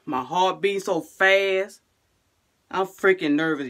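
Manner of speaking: usual speaking voice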